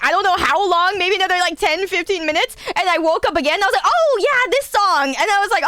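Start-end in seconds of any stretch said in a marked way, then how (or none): 3.9-5.1 excited. Retold or imitated. Acting out groggy excitement.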